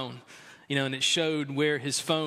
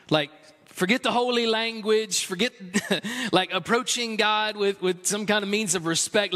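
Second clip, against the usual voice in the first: higher, louder impassioned preaching voice; 6 semitones higher, 4 dB louder than usual. Acted. Pastor rallying his congregation, higher and louder preaching. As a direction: press the congregation with rising fervor.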